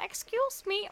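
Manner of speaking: shy, high-pitched excuse me; timid polite ask. Retold or imitated. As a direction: ask a stranger meekly for help. Approaching a stranger for help.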